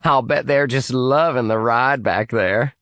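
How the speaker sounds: gleeful. Acted. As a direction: relish their misery. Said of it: "she said gleefully".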